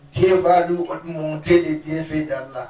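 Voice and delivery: ordinary delivery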